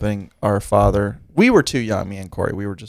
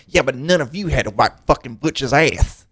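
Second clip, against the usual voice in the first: imitating aunt; 3 semitones higher than usual. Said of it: "that's exactly how she sounds".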